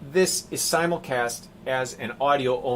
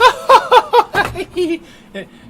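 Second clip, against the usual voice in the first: high, loud cackling hag laugh; hag cackle; 13 dB louder than usual. Retold or imitated. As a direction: cackle like a witch. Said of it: "like a hag like I just sounded".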